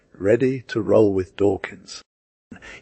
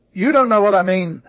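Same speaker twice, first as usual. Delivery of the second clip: put-on "You don't know what I mean" in a much higher, louder, odd foreign-sounding accent; strange new accent; 11 semitones higher, 4 dB louder, faster than usual. A narrator's reading. Blewett mocks his cheating partner in an odd accent. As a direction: twist the line into an accent never heard before. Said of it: "in an accent such as I never before heard".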